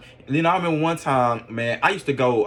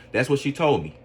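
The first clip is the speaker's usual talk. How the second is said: ordinary delivery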